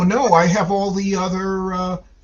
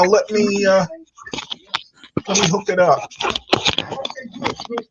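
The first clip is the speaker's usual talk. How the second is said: ordinary delivery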